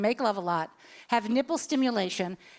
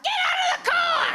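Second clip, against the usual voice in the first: much higher frantic shout; yelling. Retold or imitated. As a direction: yell him out of the car. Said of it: "I'm like".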